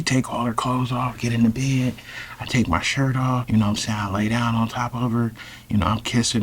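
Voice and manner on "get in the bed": ordinary talk, nothing marked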